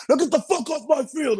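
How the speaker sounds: very high-pitched imitated sergeant's order; Drill Sergeant Walsh. Drill sergeant dismissing recruit from field, imitated fierce order. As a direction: send the recruit packing. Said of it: "Drill Sergeant Walsh comes up to me".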